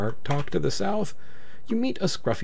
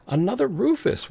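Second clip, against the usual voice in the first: surprised; 12 semitones higher than usual. Real, not acted. Surprised at second guard.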